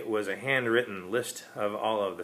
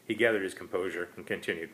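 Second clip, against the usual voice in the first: ordinary delivery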